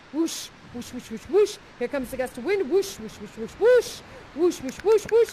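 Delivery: breathy wind noises; whooshing wind. Shown as a demonstration. Leading the spirits as gusts of wind, whooshing. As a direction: blow like a gust of wind. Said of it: "Go be gusts of wind".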